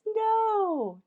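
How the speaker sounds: loud, high mock alarm; loud comic oh-no to the kids; hammed-up dismay. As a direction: wail it in playful panic. Character dismayed by snow.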